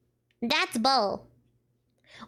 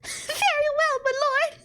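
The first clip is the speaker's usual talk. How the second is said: very high put-on character voice; high-pitched attempt at a character voice; 13 semitones higher than usual. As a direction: squeak it out as a hammed-up servant's reply. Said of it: "what even was his voice?".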